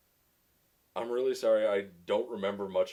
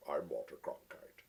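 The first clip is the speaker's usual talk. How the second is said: Walter Cronkite sign-off; 2 semitones lower, 8 dB quieter than usual. Retold or imitated. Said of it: "do a Walter Cronkite sign-off".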